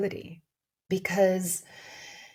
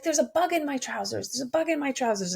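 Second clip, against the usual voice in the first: higher, lightly mimicked panic; imitating panicked babysitter; 7 semitones higher, faster than usual. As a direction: echo the babysitter freaking out, pitched up. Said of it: "she's kind of freaking out".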